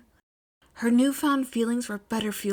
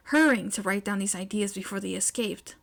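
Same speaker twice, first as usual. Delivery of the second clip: ordinary delivery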